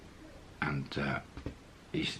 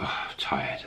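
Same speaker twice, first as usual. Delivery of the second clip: tired mumble; 4 semitones lower, 6 dB quieter than usual. Retold or imitated. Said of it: "Solomon's like".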